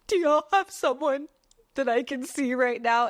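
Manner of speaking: high, pleading retold voice; desperate request. Retold desperate request at dentist's desk.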